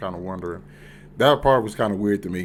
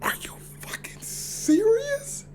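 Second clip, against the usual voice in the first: slow whispered disbelief; hushed shock; stunned whisper; slower than usual. Retold or imitated. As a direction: breathe it out, stunned. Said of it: "I'm just like".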